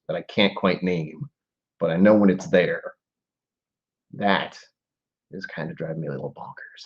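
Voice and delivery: ordinary talk, nothing marked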